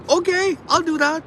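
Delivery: high, loud, gullible yes; mock naive agreement. Mocking himself for taking the neighbor's advice, a high gullible yes. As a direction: play dumb and agree eagerly. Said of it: "I'm like".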